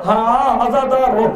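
ordinary talk, nothing marked

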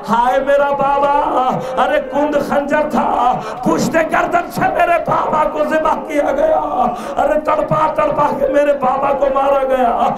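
shouted mourning, ends of phrases stretched; wailing lament. Acted. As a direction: wail it loudly to a mourning crowd. Acted lament for slain father.

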